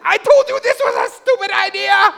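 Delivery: high, loud, fed-up scolding shriek; scolding wife. Retold or imitated. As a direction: yell it like a frightened, furious wife. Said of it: "rolled up the window and said".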